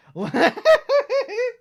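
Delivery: high, loud laugh bursting out; real laughing fit; loud burst of laughter. Real, not acted. Can't hold back laughter.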